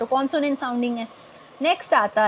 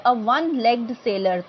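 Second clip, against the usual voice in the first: slow careful example; much slower than usual. Shown as a demonstration. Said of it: "teacher repeats example slowly".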